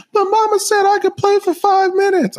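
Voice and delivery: put-on child voice. Dad voicing his young daughter.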